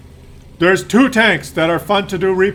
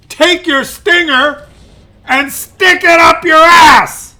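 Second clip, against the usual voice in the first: loud, higher yelling taunt; mock furious shout; 7 semitones higher, 6 dB louder than usual. The mouse yells at the stinger tank, loud and higher. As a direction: hurl it at the enemy.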